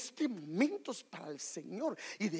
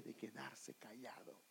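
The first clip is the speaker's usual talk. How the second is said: whisper; 9 semitones lower, 15 dB quieter than usual. Real, not acted.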